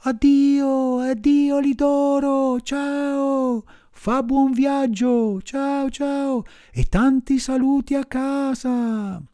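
high puppet voice calling out goodbyes; high called-out puppet farewell. A narrator's reading. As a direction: call cheerfully across the water. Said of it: "gridò all'amico salvato".

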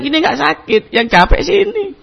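pitched well up, a mocking whine; high mock voice. Person being massaged answers the stranger, high mocking voice. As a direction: squeak it up high and complaining.

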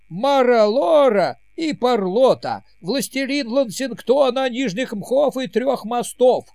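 high, loud herald's proclamation; town crier. Acted. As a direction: announce it like a royal herald. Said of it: "раздался голос Герольда".

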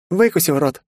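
high, cackly taunt without a full laugh; jeering laugh-line. A narrator's reading. Margaret escapes through the hatch, jeering gleefully. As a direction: toss it back as a gleeful parting insult. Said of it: "хохотнула она".

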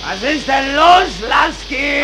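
slow loud high shout. Acted. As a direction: yell across the room. Shouting over noise. (laut rufend)

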